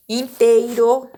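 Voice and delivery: "Inteiro" said slowly and loudly, syllable by syllable; teacher sounding out "Inteiro" slowly for pupils; syllable demo. Shown as a demonstration. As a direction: stretch out "Inteiro" so each syllable is heard. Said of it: "você vai fazer a separação da sílaba".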